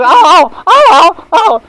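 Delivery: very high, loud bleating wail; calf's cry. Retold or imitated. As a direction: wail like a frightened calf. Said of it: "And the calf".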